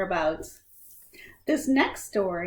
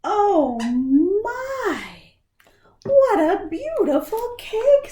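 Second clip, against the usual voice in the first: much higher, slow, gushing grandma voice; delighted Nana; 12 semitones higher, 10 dB louder, much slower than usual. Acted. Nana admiring the cake she just got, high, slow and gushing. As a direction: coo over the cake like a thrilled grandma. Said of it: "says Nana".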